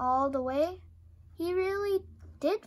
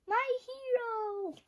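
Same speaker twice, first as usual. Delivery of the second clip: much higher, slow and dreamy; swooning mouse; 10 semitones higher than usual. A narrator's reading. A rescued mouse swooning over Super Bat, dreamy. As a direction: sigh it with adoration.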